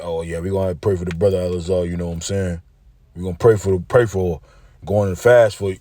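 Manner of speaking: a hint of a mocking impression; mocking the rivals. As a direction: sneer through their pious talk. Mocking rival camp's prayers.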